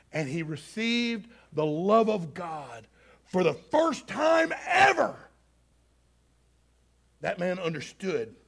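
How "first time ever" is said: exultant peak. Real, not acted. Climax of the conversion story.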